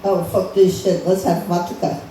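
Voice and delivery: put-on Russian accent. Comic voices Russian leader refusing the joint, Russian accent. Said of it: "the Russian guy's gonna be like".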